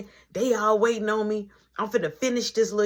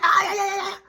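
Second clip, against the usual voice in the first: much higher, louder yeah yeah; loud mock scolding; 12 semitones higher, 5 dB louder, faster than usual. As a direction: cut them off like a fed-up boss. Manager shutting it down.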